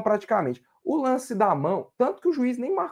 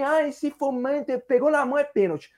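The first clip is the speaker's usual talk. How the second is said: higher, mocking quote; imitating a colleague; 6 semitones higher than usual. Panelist quotes colleague arguing for a penalty, mocking. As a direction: lightly mimic his argument in a raised pitch. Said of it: "E o Dudu tá falando aí".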